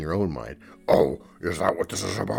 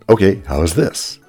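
much louder, turned up; 13 dB louder than usual. Acted. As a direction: crank the volume up. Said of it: "not with the audio fader".

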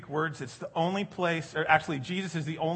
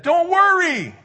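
big high call, much louder; shouted don't worry; 14 dB louder, slower than usual. Retold or imitated. Pastor quoting someone, called out loud. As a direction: call it out to the whole room.

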